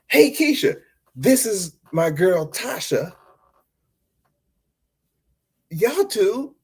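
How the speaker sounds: high, eager introducing voice; gushing as he makes the introductions; overexcited introduction. Retold or imitated. Guy introducing the coworker to his girl, high and eager. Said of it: "I'm just giving them fictitious names".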